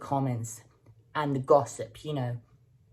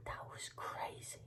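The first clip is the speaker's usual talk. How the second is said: whisper; 18 dB quieter than usual. Real, not acted. Awed aside about internet beef.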